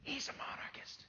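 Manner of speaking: whispered aside, barely voiced; hushed, telltale whisper to the crowd; stage whisper. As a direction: whisper it behind his back. Mock aside behind another's back.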